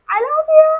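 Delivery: very high, drawn-out cutesy farewell; affectionate sign-off. Real, not acted. Host signing off to her tribe, high affectionate lilt. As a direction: send love in a high sing-song.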